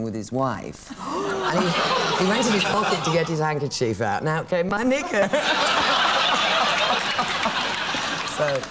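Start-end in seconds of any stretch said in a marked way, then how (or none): 5.3-8.3 a long high airy cackle; high breathless laugh. Real, not acted. She laughs at the knickers punchline, breathless high laugh. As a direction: let the laugh take over.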